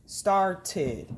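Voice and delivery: "Started" as two separate beats, star-tid; clapped-out syllables. Shown as a demonstration. Teacher modelling for pupils, "Started" syllable by syllable. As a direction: split "Started" with a crisp t on the second syllable. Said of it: "Can you clap out started?".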